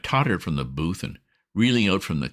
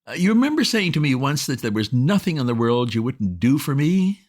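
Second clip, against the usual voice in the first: put-on higher, bright-eyed wheedling girl's voice; coaxing young woman; 9 semitones higher, 4 dB louder than usual. A narrator's reading. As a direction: sweet-talk him over the phone, higher and louder. Bobbie Wickham on the phone.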